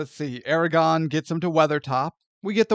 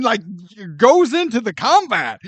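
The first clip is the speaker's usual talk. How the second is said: normal talking voice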